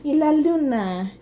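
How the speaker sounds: ordinary delivery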